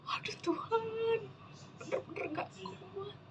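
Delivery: high, soft, mock-weeping prayer; retold tearful plea. Woman crying to God that she can't cope, retold tearful plea. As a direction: re-enact a weak, tearful cry for help. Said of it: "Menangis yang".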